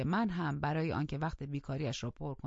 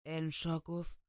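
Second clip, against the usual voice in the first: ordinary delivery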